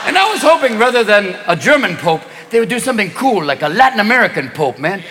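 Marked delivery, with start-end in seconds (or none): none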